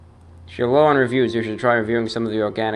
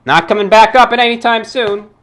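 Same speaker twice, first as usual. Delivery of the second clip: sharp, much higher and louder declaration; defiant outburst; 11 semitones higher, 7 dB louder than usual. Real, not acted. Fed up with forum trolls, he declares it loudly and high. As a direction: slam the door on the trolls, loud and high. Shutting comments after abuse.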